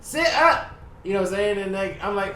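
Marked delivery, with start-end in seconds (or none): 0.0-0.7 urgent call. Retold or imitated. Urging his unresponsive mother.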